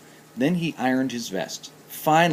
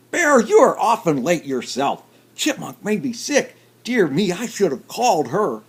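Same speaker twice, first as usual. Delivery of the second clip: higher, fussy duck voice; 7 semitones higher, 6 dB louder than usual. Acted. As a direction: speak like a worried little duck. Duck character voice.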